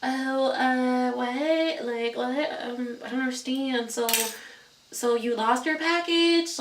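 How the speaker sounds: high, far-off valley-girl Californian, mocking the FedEx agent; mock Cali accent. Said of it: "they got their little Cali accents".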